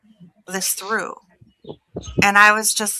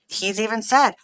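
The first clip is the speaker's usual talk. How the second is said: ordinary talk, nothing marked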